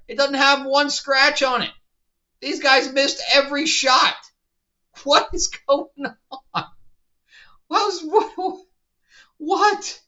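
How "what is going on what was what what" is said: higher, stunned what-what sputter; bewildered disbelief. Real, not acted. Reviewer reacting to bad writing, stunned and sputtering. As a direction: sputter in total bewilderment at the show.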